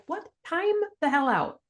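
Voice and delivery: high, incredulous outburst; shocked disbelief. Retold or imitated. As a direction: jump up in pitch, stunned. Shocked reply to death news.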